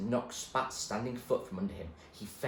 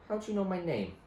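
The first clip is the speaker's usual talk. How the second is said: higher, wary question; suspicious girl; 10 semitones higher than usual. Acted. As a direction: demand it warily. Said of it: "she asked suspiciously".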